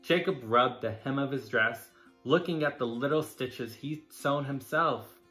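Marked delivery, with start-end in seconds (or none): none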